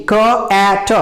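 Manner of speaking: "क ए ट" as separate sounds, k-ae-t; sounded out. Shown as a demonstration. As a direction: break "क ए ट" into three slow phonemes. Teacher sounds out cat slowly. ("क ए ट" अलग-अलग ध्वनियों में)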